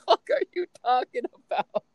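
retold disbelieving outburst; incredulous. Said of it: "I'm like".

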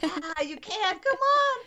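rising, high encouraging call; cheering her on. Retold or imitated. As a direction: urge her on, pitch leaping at the end. Cheering a mother on in labor.

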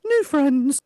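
high, teasing put-on voice; mock-cute high pitch; teasing label. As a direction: pipe it up high as a tease. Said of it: "Would you say that they're called".

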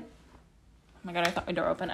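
low, soft, sudden hush; startled hush. Real, not acted. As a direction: drop the voice, startled. Startled by the door.

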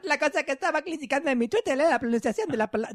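high, mangled mock-foreign accent; failed Mexican accent. Host's third try at the email, failed Mexican accent. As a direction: squeak through a badly faked Mexican accent. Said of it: "no, tampoco".

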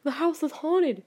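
high, slowed mock-spooky voice; mock spooked friend. Imitating her friend blaming a haunted house. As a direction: ham up his ghost fear. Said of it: "he'll be like".

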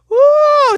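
sudden high woo, loud; high, loud frustrated woo; loud high whoop. Real, not acted. Exclamation of frustration.

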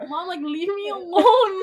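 high, pleading, on the verge of crying; near tears. Retold or imitated. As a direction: beg mom to stop, voice cracking. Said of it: "I'm like".

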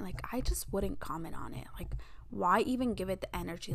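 hushed, breathy whisper to herself; whispered thought. Retold or imitated. Her past self privately vowing to ignore hate comments, whispered.

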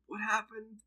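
high-pitched mock confusion; retold bewilderment. Retelling himself forgetting he took drugs, high bewildered question. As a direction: ask it high, as if baffled.

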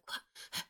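sudden mock gasp of alarm; short acted intake of breath; imitated gasp. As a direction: gasp like a startled group. Everyone hears a noise.

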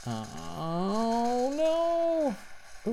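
high, pitch climbing dismay; rising alarmed uh oh no. Real, not acted. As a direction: let the no shoot up in alarm. About to crash in game.

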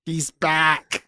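mock child-actor melodrama, held back; half-hearted rerun of a dramatic cry; Harry Potter. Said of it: "then it cuts to Harry, and he's like".

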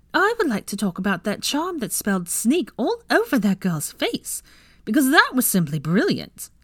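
high, eager gushing; excited. Acted. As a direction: gush about how brilliant it was. Pansy excited about charm.

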